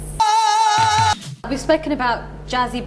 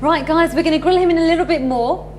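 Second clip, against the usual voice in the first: excited; 6 semitones higher, 5 dB louder than usual. Real, not acted. Host hypes the audience.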